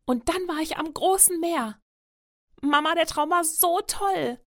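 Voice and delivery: excited youngster, bright-eyed dreamer; gushing. A narrator's reading. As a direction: rave about a wonderful dream. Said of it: "schwärmt Leon".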